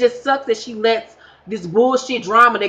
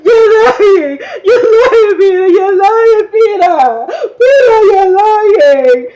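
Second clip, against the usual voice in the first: hammed-up shrieking sobbing wails, much higher and louder; impersonating Amina crying; 12 semitones higher, 8 dB louder than usual. Mock-sobbing shriek at Peter over a pregnancy. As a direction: wail at Peter like a heartbroken girlfriend. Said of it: "here's my impersonation of Amina".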